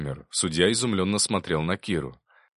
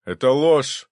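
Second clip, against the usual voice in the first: loud, high cry from the gallery; outraged shout; 6 semitones higher, 8 dB louder, slower than usual. A narrator's reading. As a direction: shout down the testimony. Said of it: "выкрикнул с места". (возмущённый выкрик с места)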